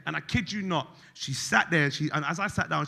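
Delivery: ordinary talk, nothing marked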